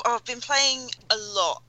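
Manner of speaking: ordinary talk, nothing marked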